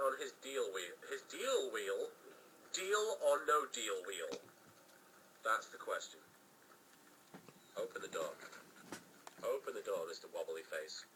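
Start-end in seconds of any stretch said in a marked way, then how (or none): none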